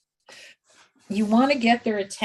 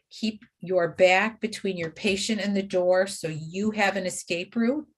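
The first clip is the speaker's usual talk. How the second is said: normal talking voice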